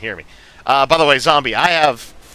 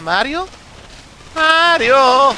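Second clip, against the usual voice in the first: higher calling out Mario!; Luigi call; 9 semitones higher, slower than usual. Acted. Playing Luigi and calling for his brother, high and eager.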